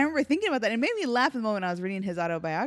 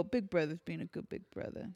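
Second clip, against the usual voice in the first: ordinary talk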